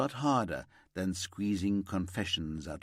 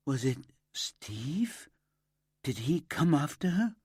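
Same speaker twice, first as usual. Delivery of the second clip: fearful whisper; 5 semitones higher than usual. A narrator's reading. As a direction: whisper the sudden suspicion. Sudden fearful suspicion.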